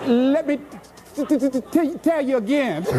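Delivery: stuck on the t, laughing; laughing stammer impression; imitating a stutter. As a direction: act out the friend's stammer with amusement. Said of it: "she stutters".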